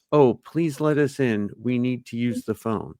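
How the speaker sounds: stilted, formal line learned by heart; memorized polite plea. Acted. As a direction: recite it like an awkward, overly polite kid. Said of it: "memorize lines that they just kind of repeat".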